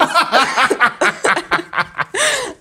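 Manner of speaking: a long amused laugh. Real, not acted. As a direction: let the laugh roll out. Laughs after joke.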